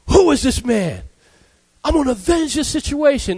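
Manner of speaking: David flaring up in outrage at the poor man's story; angry David. Retold or imitated. King demanding who stole the poor man's sheep, angry. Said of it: "David gets mad and said".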